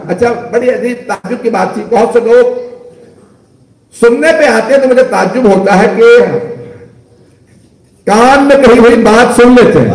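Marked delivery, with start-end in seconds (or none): 1.9-9.9 raised pulpit voice, climbing higher; impassioned preaching. Real, not acted. Preacher marvels at Muslims' selective hearing, impassioned and rising. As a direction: build astonishment towards a high, forceful cry. Preacher builds indignant astonishment to climax.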